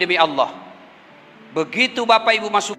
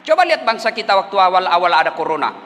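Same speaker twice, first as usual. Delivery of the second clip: normal talking voice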